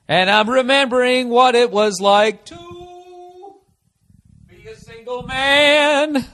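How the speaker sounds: slow, high, mock-tragic crooning; melodramatic lament. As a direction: sing out your loneliness like a ham. Dramatic lament for absent girlfriend.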